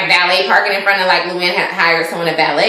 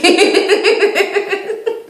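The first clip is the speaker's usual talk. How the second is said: helpless rising giggle; long, high real laughter; high giggling fit; 10 semitones higher than usual. Real, not acted. Recapper cracks up at Ramona's plan, high giggles.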